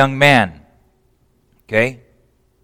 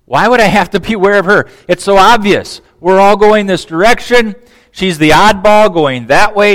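higher, louder know-it-all son; cocky son; 5 semitones higher, 5 dB louder than usual. Pretend. Son scoffing at his father's warning, cocky and sure. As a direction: brush off dad's warning confidently.

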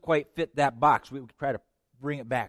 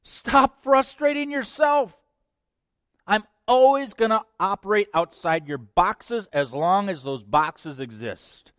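God's command; 8 semitones higher, 6 dB louder than usual. Acted. God telling the believer off, loud and insistent. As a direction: order it with authority. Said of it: "God says".

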